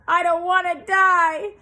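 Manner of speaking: high, very loud scream. A narrator's reading. Pig screams on the ground at the sheep, high and very loud. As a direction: throw himself down screaming. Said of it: "screamed Wilbur".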